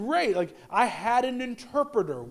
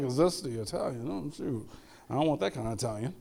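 low, quiet, taken-aback youngster; mock Gen Zer; 8 semitones lower, 7 dB quieter than usual. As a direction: imitate a young person balking under their breath. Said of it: "Everybody who's a Gen Zer, they're like".

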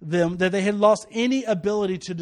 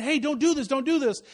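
higher-pitched retold plea to stop; urgent warning; 6 semitones higher than usual. Preacher raising his hand to warn society, urgently pleading. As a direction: call out a warning, raised in pitch. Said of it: "I'm raising my hand and saying".

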